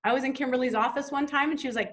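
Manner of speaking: ordinary talk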